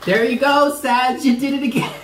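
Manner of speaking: real loud, high tearful outburst; crying. Real, not acted. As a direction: cry it out high and loud, breaking into laughter. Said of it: "You're making me cry again".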